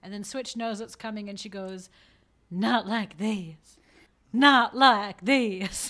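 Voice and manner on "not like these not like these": drawn-out, louder despairing plea; slow dying plea. Retold or imitated. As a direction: stretch each word out like a last breath. Switch's dying words, retold.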